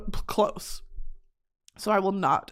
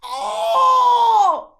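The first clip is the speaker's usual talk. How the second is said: high hammed-up shriek, much higher and louder; mock Wilhelm scream; 14 semitones higher, 6 dB louder than usual. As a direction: shriek like a movie death scream. Wilhelm scream at skin burn.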